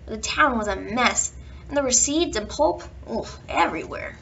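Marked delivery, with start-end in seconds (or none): none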